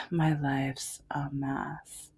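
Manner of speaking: breathy whispered aside, slow; hushed, slow self-pity whisper. Real, not acted. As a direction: drop to a slow whisper to yourself. Repeats line as a whisper.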